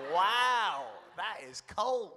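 high, loud wow, then drawn-out words; stunned reproach. Retold or imitated. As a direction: react as if shocked by the coldness. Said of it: "I was like".

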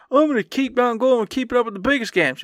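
high, fast gushing mockery; mocking past promise. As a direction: rattle off a naive boast up high.

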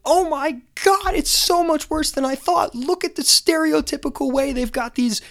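hammed-up protester outrage; mock outraged protesters. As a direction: shriek it like scandalised protesters. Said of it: "they're all yelling".

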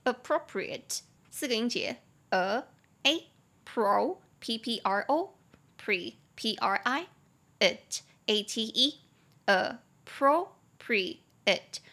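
a-pro-pri-ate broken into parts and spelled out; slow spelled-out appropriate. Shown as a demonstration. Teacher slowly spelling appropriate again because it is hard. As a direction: spell appropriate syllable by syllable, very slowly, then say it whole. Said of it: "appropriate这个字蛮难的，我再说一次哦".

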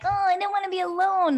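high, far whiny voice; mock needy whine. Speaker mocking her old needy self, high and whiny. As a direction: whine it like a clingy younger self.

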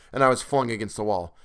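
ordinary delivery, nothing marked

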